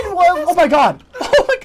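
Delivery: high laughing disbelief. Real, not acted. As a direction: laugh it out in delight.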